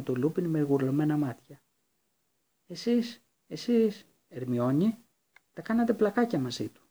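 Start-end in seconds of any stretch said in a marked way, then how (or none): 2.7-6.8 shocked accusation. Acted. Hermione accusing Lupin, shocked.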